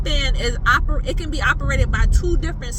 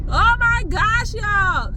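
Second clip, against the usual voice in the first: high, drawn-out panicked wail; squealed horror; freaked out; 7 semitones higher, slower than usual. Real, not acted. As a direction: react in real alarm to a creature close by. Spots a lizard on car.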